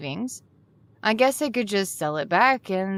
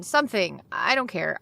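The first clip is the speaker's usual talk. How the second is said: ordinary delivery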